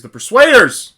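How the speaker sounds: loud burst on the show title; exclaimed title. Shown as a demonstration. Reading a biography aloud, title exclaimed. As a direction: read it with a big exclamation point. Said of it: "This is an exclamation point".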